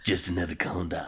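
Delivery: sexy devil voice. Pretend. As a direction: tempt them softly, like the devil. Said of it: "Sexy voice for the devil".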